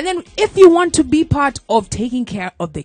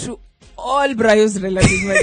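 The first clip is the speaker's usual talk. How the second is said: radio shout-out; 5 semitones higher, 6 dB louder, slower than usual. Acted. Radio shout-out to guest's relatives.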